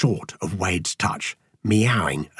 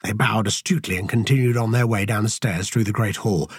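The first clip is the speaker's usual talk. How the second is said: ordinary delivery, nothing marked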